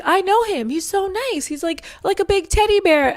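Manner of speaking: high, gushing friend voice; light mock of a sweet friend; imitating friend. Friend recalling how nice he seemed, gushing high. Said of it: "She's like".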